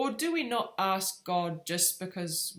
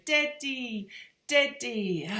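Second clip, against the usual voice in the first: put-on higher-pitched toddler repeating himself; imitating toddler; 6 semitones higher, much slower than usual. Mum imitating her son during nappy change, higher toddler voice. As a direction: chant it lightly like a toddler, each word falling. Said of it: "he just kept saying".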